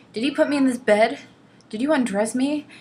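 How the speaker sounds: hammed-up panicked questions; mock freaking out. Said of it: "she starts freaking out".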